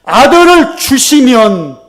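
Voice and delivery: "아들을 주시면" very loud, high and drawn out; emphatic changed prayer. Shown as a demonstration. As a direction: proclaim "아들을 주시면" slowly at full force. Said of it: "그 기도의 내용이 살짝 바뀌었어요".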